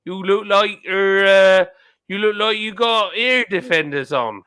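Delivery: broad West Country impression with stretched vowels; West Country accent. Shown as a demonstration. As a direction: demonstrate how West Country locals talk. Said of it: "They talk like this".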